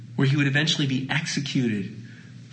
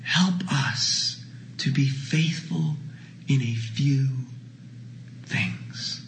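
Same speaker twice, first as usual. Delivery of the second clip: slow, breathy, hushed; a slow, whispered prayer; 3 dB quieter, much slower than usual. Real, not acted. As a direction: pray it in a whisper.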